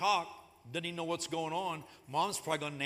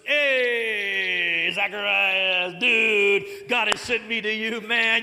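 loud, much higher surfer-dude Gabriel, half sung; laid-back dude angel; 5 semitones higher, 10 dB louder than usual. Pretend. Preacher mocks a cool Gabriel telling Zacharias about a son, loud surfer-dude voice. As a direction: hype him up like a stoked surfer, man. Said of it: "Gabriel showed up and said".

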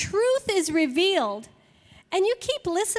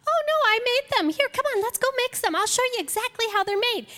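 high, quick and bright, eager to show; cheerful baker; 6 semitones higher, much faster than usual. Retold or imitated. Cookie maker coaxing a doubter to bake, high and eager. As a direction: brightly invite them to bake along. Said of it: "And she says".

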